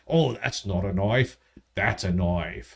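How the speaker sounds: low mock-Australian drawl; Crocodile Dundee. Host quoting the film to co-host, put-on Australian accent. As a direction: play a hammed-up Aussie tough line. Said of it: "I watch T V and Crocodile Dundee".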